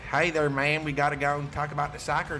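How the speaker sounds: Southern accent. Shown as a demonstration. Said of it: "like, a, a southern accent".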